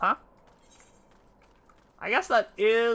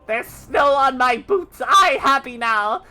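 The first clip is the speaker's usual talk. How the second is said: much higher and louder, joyful cheering; excited snowman; 7 semitones higher, 12 dB louder than usual. Acted. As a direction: cheer like a thrilled little snowman. Snowman celebrating the hit.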